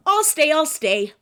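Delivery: high, loud eager yes; girl delighted about cheeseburgers. Acted. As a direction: jump at the offer. Girl eagerly accepts dinner.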